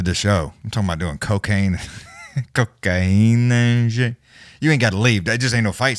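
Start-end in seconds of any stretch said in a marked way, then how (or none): 2.2-4.2 slow words drawn out through a laugh. Real, not acted. Host cracking up at his own cocaine talk, laughing.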